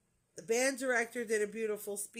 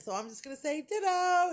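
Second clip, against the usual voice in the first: punchline thrown out higher and louder; joking choir director; 9 semitones higher, 6 dB louder than usual. Retold or imitated. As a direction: land the ditto joke with a grin. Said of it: "he's like".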